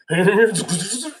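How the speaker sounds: high cackle mimicking evil children; mock eerie children's cackle; imitated evil laughter. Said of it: "the evil noise happens again".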